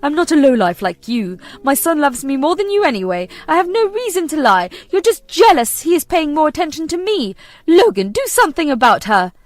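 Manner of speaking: loud, high outraged denial; indignant fury. Acted. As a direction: snap back, how dare you. Mother caught lying, lashing out.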